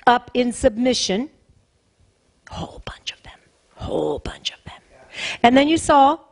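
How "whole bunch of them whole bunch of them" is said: whispered aside. Real, not acted. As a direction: whisper it confidingly to the congregation.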